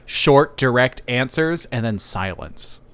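ordinary talk, nothing marked

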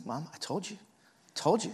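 high, gloating mock kid; imitated told-you-so, rising high; vindicated brother. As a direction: rub it in, pitch lifting. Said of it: "He's starting to feel vindicated".

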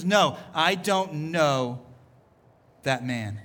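slow "don't know", dropping on "that man"; acted final denial. Peter's third denial in Jesus's sight, slow and heavy. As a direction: deny it, pause, then let "that man" fall low.

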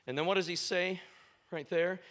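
ordinary talk

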